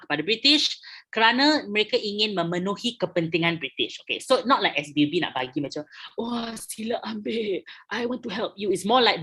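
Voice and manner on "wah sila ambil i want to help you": lightly acted, high and soft; a hint of sing-song generosity, pitched up; mock generous offer. Teacher voicing the company offering Sabah, mock generous.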